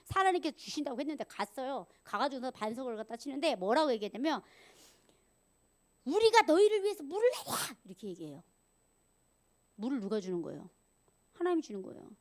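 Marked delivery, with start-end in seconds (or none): 6.0-7.8 raised, loud angry demand; angry Moses. Acted. Moses to the people at the rock, angry. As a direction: rebuke the people, pitched high and loud. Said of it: "근데 이 지도자가 화가 난 거예요".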